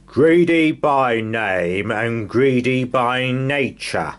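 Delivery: slow, loud bellowing giant. Acted. Giant bellows slowly at the tiny Mister Greedy. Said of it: "he bellowed".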